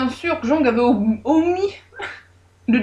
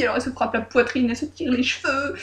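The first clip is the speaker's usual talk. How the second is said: usual speaking voice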